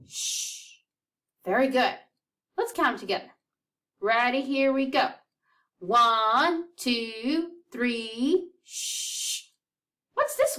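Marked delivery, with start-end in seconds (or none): 0.1-0.8 soft unvoiced shh for each rest; shushing rests. Shown as a demonstration. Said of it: "Do you remember how to say 'em?". 8.6-9.4 soft unvoiced shh for each rest; shushing rests. Shown as a demonstration. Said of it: "we shh".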